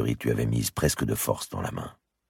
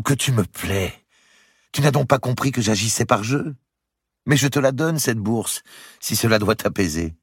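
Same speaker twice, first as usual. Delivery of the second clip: lightly voiced queen, higher and playful; teasing queen; 10 semitones higher than usual. Queen laughing at her jealous lover, higher and teasing. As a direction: tease him fondly from up high.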